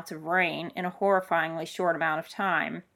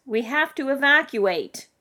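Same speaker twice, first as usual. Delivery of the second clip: higher, louder, slow urgent call; acted yell, words stretched out; 7 semitones higher, 5 dB louder than usual. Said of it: "Dominic yelled".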